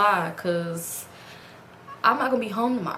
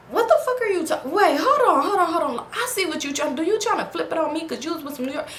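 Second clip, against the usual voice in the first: high, defensive protest; defensive boyfriend; 8 semitones higher than usual. Retold or imitated. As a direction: push back, flustered. Boyfriend defending himself, retold.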